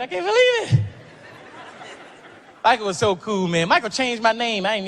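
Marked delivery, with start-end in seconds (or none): 0.0-0.8 starstruck. Retold or imitated. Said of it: "Mike, I'm sorry".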